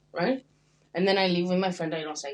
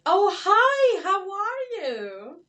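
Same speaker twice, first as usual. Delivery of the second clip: much higher, louder and slow; high chirpy greeting; 12 semitones higher, 6 dB louder, much slower than usual. Retold or imitated. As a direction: greet like an overeager stranger. Imitating stranger greeting her.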